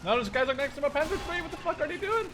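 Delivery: rally the troops mid-chaos; frantic excitement. Real, not acted. Gamer urging his units forward, high, loud and frantic.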